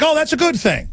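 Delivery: high-pitched mocking quote; mock approval. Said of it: "They're like".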